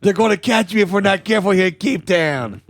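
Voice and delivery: a hammed-up Chicago gangster accent, lightly done; bad Chicago gangster accent. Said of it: "bad Chicago gangster accents".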